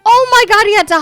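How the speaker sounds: high and loud exclamation; shocked. Real, not acted. Gamer seeing Tegan hobble away, loud high shock. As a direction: burst out at the scene.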